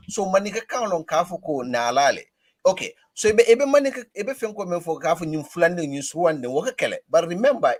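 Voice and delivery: usual speaking voice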